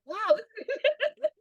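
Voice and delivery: slow, high "wow" breaking into laughter; amused disbelief at the crowd; laughing wow. Retold or imitated. Husband amazed at the concert outfits.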